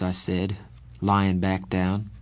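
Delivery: plain reading voice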